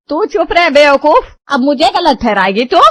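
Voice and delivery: furious shout. Acted. Mother shouting down her daughter for taking sides, furious yell.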